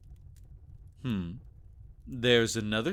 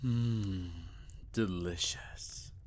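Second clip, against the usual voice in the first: whisper; 3 semitones lower, 7 dB quieter, much slower than usual. Acted. Savoring a taste, whispered.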